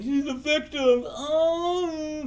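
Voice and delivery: high, loud, drawn-out fake crying; mock emotional wailing. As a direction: wail it slowly in exaggerated outrage, way up high. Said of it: "would have been triggered and emotional".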